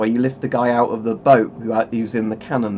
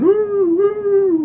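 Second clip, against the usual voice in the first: mock whoop pitched far higher; imitating a game character; 4 dB louder than usual. Streamer imitating the hay baler guy, high mock whoop. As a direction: squeal like a cartoon soldier charging. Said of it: "The guy who runs around with a stick going".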